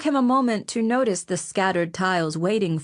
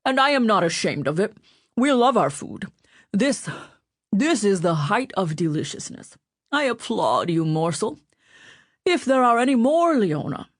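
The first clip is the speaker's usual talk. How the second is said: Mancini voice; 2 semitones higher than usual. A narrator's reading. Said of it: "spitting a few crumbs as he spoke".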